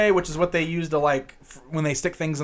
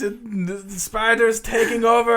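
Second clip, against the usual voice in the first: mock-dramatic captain's log, pitched well up; dying scientist voice; 6 semitones higher than usual. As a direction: ham up a scientist's last log entry. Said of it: "he just does this part where he's like".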